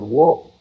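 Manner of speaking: normal talking voice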